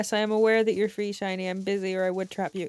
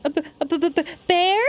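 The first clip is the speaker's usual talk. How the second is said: scared stammer; 6 semitones higher, faster than usual. Acted. Spotting a bear.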